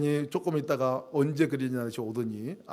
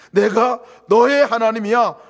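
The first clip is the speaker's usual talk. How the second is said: much louder, slow; God's proclamation; 6 semitones higher, 13 dB louder than usual. Pretend. Preacher voicing God to the church, loud and slow. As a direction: swell to full voice. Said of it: "이 음성을 들으시기를 바랍니다".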